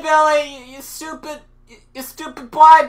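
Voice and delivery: usual speaking voice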